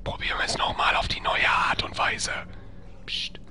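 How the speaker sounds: stealthy whisper with soft shushing; ganz leises Flüstern. Real, not acted. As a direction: keep it voiceless, as if not to be heard. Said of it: "Psst, ganz leise". (heimlich und leise flüstern)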